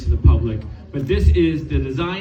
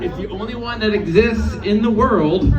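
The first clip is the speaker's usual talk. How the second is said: ordinary talk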